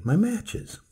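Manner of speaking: anxious cry about the matches; cried out. Acted. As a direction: plead it out in alarm. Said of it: "I cried".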